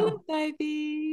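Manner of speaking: put-on high, soft, slow baby voice; mocking baby talk. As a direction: coo it like to a baby. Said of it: "talks to me in a baby voice".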